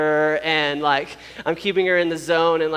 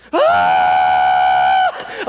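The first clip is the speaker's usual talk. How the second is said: full loud imitated labor scream; long piercing scream of pain, re-enacted; 8 dB louder than usual. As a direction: let rip a long agonized scream. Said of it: "It was a scream like".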